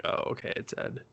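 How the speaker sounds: soft whisper of recognition; whispered realization. Retold or imitated. Viewer recognizes Ed in the wig, whispered. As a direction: breathe it out, dawning. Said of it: "I was just like".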